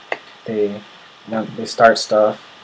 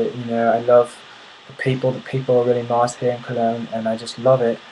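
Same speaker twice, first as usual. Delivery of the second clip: put-on British accent; 3 dB louder than usual. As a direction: imitate a chatty British reveller. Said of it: "try to speak in a British accent".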